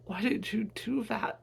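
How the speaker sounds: high, distressed questioning; anguished plea. Acted. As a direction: cry out in disbelief. Son grieving shot mother.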